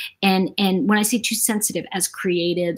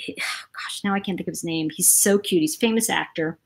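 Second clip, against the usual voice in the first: ordinary delivery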